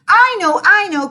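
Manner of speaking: high, loud eager cry; bursting to answer first. A narrator's reading. Missy eagerly calling out the answer, high and loud. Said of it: "cried Missy".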